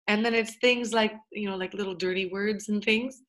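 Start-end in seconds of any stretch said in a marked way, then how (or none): none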